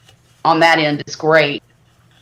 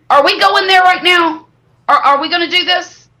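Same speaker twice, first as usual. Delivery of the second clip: higher, louder, retelling an indignant challenge; incredulous challenge; 11 semitones higher, 4 dB louder than usual. Retold or imitated. Mom retelling how she confronted the doctor, incredulous and indignant. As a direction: push back at the doctor in disbelief. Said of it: "And I said".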